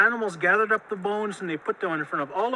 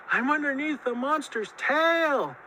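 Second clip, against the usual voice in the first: plaintive call back; 7 semitones higher than usual. A narrator's reading. Trapped Muskrat answers Coyote, high plaintive call. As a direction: answer from a trapped spot, pitched up.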